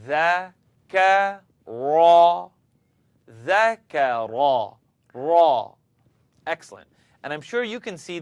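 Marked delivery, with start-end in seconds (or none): 0.1-4.8 ذكر drawn out slowly, tongue between teeth; slow tongue-out demo. Shown as a demonstration. Teacher shows ذكر slowly, tongue out. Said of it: "Bring your tongue out".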